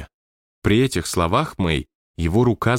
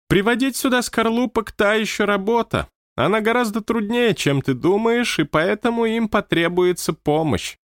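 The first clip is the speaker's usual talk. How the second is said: lightly raised, stately manager; high manager voice; 10 semitones higher than usual. Acted. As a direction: speak as the odd high-voiced boss. Manager character voice. (высокий голос руководителя)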